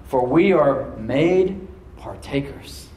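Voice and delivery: very slow, deliberate scripture reading; read slowly. Shown as a demonstration. Pastor reading King James verse to church, very slow. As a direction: draw out every word of the verse. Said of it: "I'm gonna read it slowly now".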